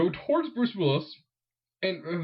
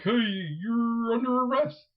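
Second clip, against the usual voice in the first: high, slow police announcement; a hammed-up cop through a loudspeaker; mock police call; 7 semitones higher, slower than usual. Police helicopters calling out the arrest.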